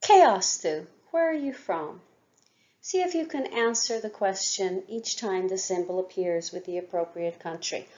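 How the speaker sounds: ordinary talk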